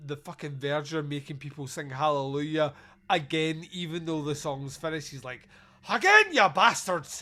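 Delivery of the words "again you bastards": loud, higher bellowed command; mock shouted order. Verger making people sing Hallelujah once more, hammed-up shouted order.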